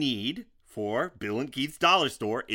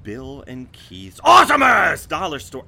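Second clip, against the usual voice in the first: booming announcer shout; monster truck ad; 12 dB louder than usual. Acted. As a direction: roar it like a monster truck rally ad. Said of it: "I can't stop saying it like that".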